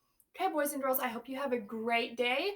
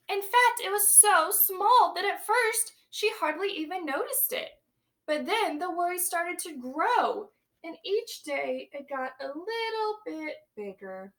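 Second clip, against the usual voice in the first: put-on lively read-aloud for young children; storybook reading; 5 semitones higher, 8 dB louder than usual. A narrator's reading. Teacher reading a picture book to her class, lively storytelling. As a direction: read it big and bright to the class.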